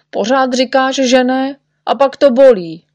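high, rising-in-volume complaint; high-pitched reproach; aggrieved protest. Acted. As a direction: accuse the doctor of false promises. Patient accuses the doctor.

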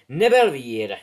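a loud, ringing call of the house; hat's loud announcement. Acted. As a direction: proclaim it to the whole hall.

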